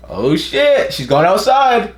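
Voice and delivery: high, drawn-out excitement; excited reaction. Real, not acted. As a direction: react with rising excitement, stretching the words.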